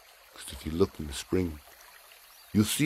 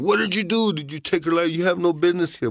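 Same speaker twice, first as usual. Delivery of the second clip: high, loud accusing voice; angry brothers; 12 semitones higher, 4 dB louder than usual. Acted. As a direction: charge at him, furious. Brothers confront Turtle.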